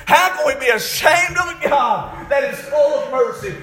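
full-voiced, driving sermon delivery; impassioned preaching. Real, not acted. Preacher urging the church to be ashamed of sin, impassioned preaching. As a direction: preach it hard to the congregation.

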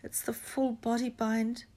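slow, high, miserable voice. A narrator's reading. Hermione admitting what she did to Neville, miserable. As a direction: confess it slowly and miserably. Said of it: "said Hermione miserably".

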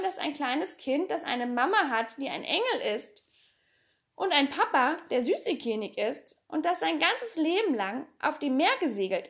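put-on quick, bright girl's voice spinning excuses; chatty Pippi. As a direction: talk fast and cheerful as if lying were perfectly normal. Reader voices Pippi explaining.

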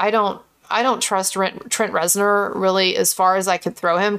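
normal talking voice

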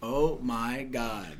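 low, slow, stunned oh my God; hushed awe. Retold or imitated. Caller retelling his own stunned reply to the host. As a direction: breathe it out slowly in disbelief. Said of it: "I said".

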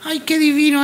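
usual speaking voice

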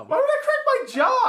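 very high, loud incredulous yell; indignant high-pitched outburst; pissed off. Real, not acted. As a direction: shriek the question in disbelief. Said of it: "so incredibly pissed off".

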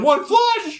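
high triumphant yell; excited shout. Retold or imitated. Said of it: "I'm like".

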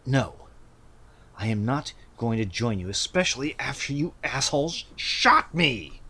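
growled, snarled refusal; feral snarling. Acted. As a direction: snarl it out like a cornered thug. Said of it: "growls and snarls and says".

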